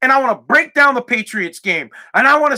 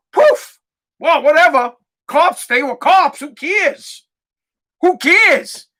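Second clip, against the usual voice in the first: sarcastic indifference; 4 semitones higher, slower than usual. Retold or imitated. Sarcastically voices indifferent public.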